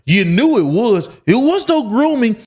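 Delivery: ranting herself into outrage; getting worked up. Real, not acted. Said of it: "before I got triggered".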